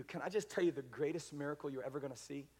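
ordinary talk, nothing marked

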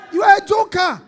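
high, very loud jeer; shouted ridicule. Acted. Preacher ridicules the unready soldier, high loud shout. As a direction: shout the taunt at full voice.

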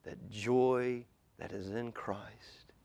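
soft, reverent near-whisper; hushed preaching. Acted. Preacher pointing to the joy in Christ, hushed preaching. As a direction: let the sermon sink to a hush. Sermon drops to hushed close.

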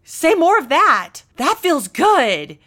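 high, loud, hammed-up begging for praise; mock craving praise. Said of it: "we think it would be awful to go".

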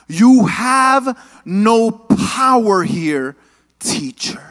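slow, drawn-out menacing line; a hint of a possessed girl; demon voice. Possessed Lilith defying Nicodemus, slow and menacing. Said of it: "she says these words".

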